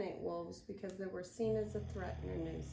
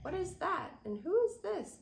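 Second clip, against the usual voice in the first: curious pups; 10 semitones higher, 6 dB louder than usual. Acted.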